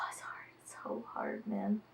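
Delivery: sighed out, very soft, near whisper; weary. Real, not acted. Vlogger struggling with early mornings, weary. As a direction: breathe it out, defeated and hushed.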